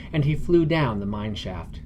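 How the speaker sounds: ordinary delivery, nothing marked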